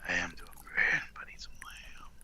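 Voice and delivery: sexy whisper. Pretend. Whispered wolf seduction bit.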